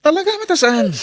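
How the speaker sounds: high, loud yell; panicked high shout. Acted. Husband yelling in fight.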